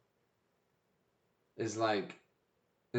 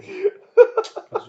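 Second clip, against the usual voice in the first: laughing; 16 dB louder than usual. Real, not acted. Laughing at dub idea.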